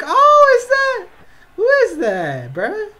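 loud, very high shriek; startled shout. Real, not acted. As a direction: burst out in shrill surprise. Startled by a catch.

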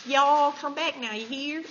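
playful imitated Southern twang; Southern accent. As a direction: put on a folksy Southern farewell. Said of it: "I'm talking like Southern".

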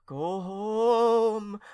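high, loud wailing call; aunt wailing across the streets. Acted. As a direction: wail it out. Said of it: "they heard her wail".

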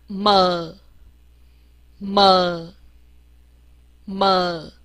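么 as a low, drawn-out "muh"; 字母发音示范. Shown as a demonstration. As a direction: hum into 么 and let it fall. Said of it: "我们念一下么". (低而拉长地念“么”)

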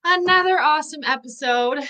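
high-pitched, drawn-up host welcome; show-opening greeting. Real, not acted. Host opens the live show.

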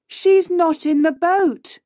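high-pitched alarm; alarmed reply. A narrator's reading. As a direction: report it urgently, pitched high. Friend missing after wave hits boat.

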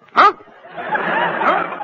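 high, loud startled huh with a laugh; shocked yelp. Acted. Man hearing the stranger is his wife, squeaking in shock. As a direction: jolt up the scale in pure shock.

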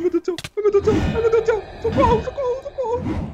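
high, frantic pleading; panicked cries for help. Real, not acted. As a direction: squeal for rescue under attack. Attacked by mages. (gritinhos agudos de socorro)

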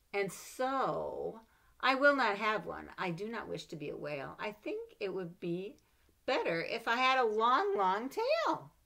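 put-on lively read-aloud for children; story reading. A narrator's reading. Mother reading a picture book to her child, lively.

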